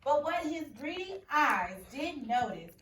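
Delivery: expressive picture-book read-aloud; story reading. A narrator's reading. Teacher reading a picture book to her class.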